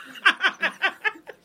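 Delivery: high burst of laughter; loud laugh. Real, not acted. Cracking up at her own imagined threat, loud laugh.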